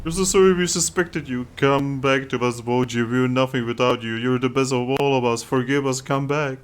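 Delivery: mildly pleading, apologetic voice; remorseful appeal; pleading crowd. Acted. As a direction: beg someone to return.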